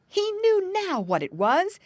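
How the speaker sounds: high, loud burst of discovery; sudden realization. A narrator's reading. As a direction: announce the discovery with a jolt.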